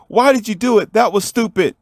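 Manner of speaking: high-pitched reproachful question; upset scolding. Acted. Sister asks her rescuer brother why, high upset scolding.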